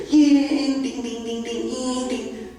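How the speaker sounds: high, ringing downhill whizz; imitated speeding bicycle. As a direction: mimic a bike racing downhill.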